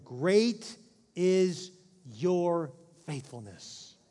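"Great" pushed out loud, then "your faithfulness" drawn out very slowly; solemn scripture reading. Acted.

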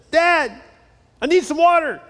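put-on high, loud little boy calling down the hall; kid calling out. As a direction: whine for water from bed. Child calling from bedroom.

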